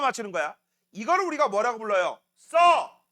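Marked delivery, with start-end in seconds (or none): 2.4-3.0 loud, higher one-word order; sharp command. Real, not acted. Teacher ordering students to write, sharp loud command. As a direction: punch it out as an order.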